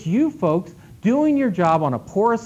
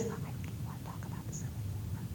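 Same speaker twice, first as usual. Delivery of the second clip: whispered, voiceless and very soft; 20 dB quieter than usual. Shown as a demonstration. Patron asking the librarian, in a whisper. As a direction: barely breathe the words out. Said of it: "they talk like this".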